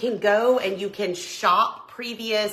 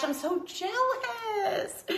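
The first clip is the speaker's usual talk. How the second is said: mock whiny jealousy; 13 semitones higher, 6 dB quieter, much slower than usual. Jealous of viewer's snow.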